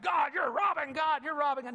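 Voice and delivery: hollering accusation from the pulpit; old school preacher. Shown as a demonstration. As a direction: thunder it out like an old-time revival preacher. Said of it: "you go old school preacher on 'em".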